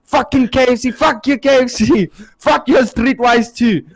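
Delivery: high-pitched imitated drunken ranting; jokingly angry. Punching friend cursing his K F C, jokingly angry. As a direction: rant it over and over in mock rage. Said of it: "starts getting, like, jokingly angry".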